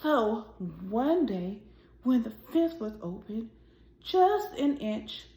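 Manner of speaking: gentle, expressive storybook reading; reading to children. A narrator's reading. Teacher reading a picture book aloud to her class, expressive storytelling. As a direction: read it like story time for little kids.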